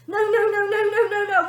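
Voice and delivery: high, loud repeated no; furious big sister refusing; snapping girl. Acted. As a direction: snap no over and over. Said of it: "snapped the girl".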